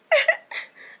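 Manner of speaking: squealing laugh; high nervous laugh. Real, not acted. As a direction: laugh off the panic.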